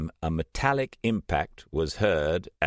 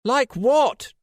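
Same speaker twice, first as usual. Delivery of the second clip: much higher, louder angry retort; fuming; 8 dB louder than usual. A narrator's reading. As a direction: spit it back furious. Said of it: "still fuming".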